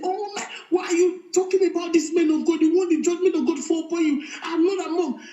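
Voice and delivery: high, fast mock protest; retold scolding. Wife retelling how she scolded her husband, mock protest.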